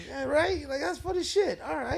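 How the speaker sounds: mock clueless comic. Meme comic asking a silent crowd for approval, high goofy voice.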